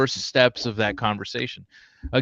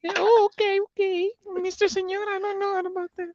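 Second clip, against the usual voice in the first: put-on much higher, softer squeaky girl voice; high pitch voice; 6 dB quieter than usual. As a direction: play the girl in a squeaky falsetto, half laughing. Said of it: "we have the high pitch one".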